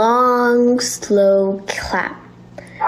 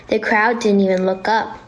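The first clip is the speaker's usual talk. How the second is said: ordinary delivery, nothing marked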